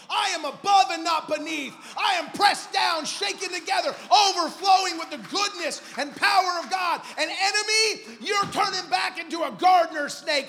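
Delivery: full-force, high-pitched preacher's shout; explosive, commanding battle cry of faith; yelled declaration. Acted. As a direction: roar it defiantly at the enemy. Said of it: "Start yelling out Jesus".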